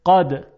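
قد opened with a hard uvular stop; qaf pronunciation. Shown as a demonstration. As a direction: start قد with a firm ق. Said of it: "با قاف". (تلفظ قد با قاف)

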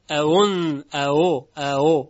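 short loud bursts of اَأُ, second hamza softened; isolated tashil drill. Shown as a demonstration. Teacher drills the first syllable, softened hamza.

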